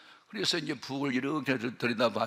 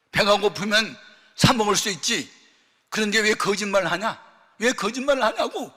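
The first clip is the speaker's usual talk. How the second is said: higher and louder, anguished reproach; tearful scolding; 7 semitones higher, 9 dB louder than usual. Retold or imitated. Weeping mother reproaching her son, loud and anguished. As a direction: demand why he lied.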